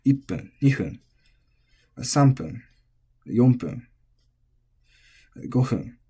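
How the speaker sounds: Ippun, nihun, sanpun, yonpun, gohun each accented on pun; counting minutes with clear accents; pitch accent demo. Shown as a demonstration. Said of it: "put an accent on it".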